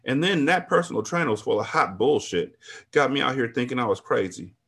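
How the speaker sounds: ordinary talk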